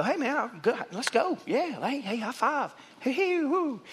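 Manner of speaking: mock cheerful congregant. Imitating happy churchgoers leaving after a grace sermon, laughing and high-fiving. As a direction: play a buoyant churchgoer after a feel-good sermon.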